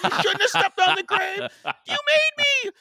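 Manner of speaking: very high, loud mock screaming; hammed-up frantic retelling; shrieking panic. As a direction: squeal it out as an imitation of a panicked kid. Imitating panicked daughter and father arguing.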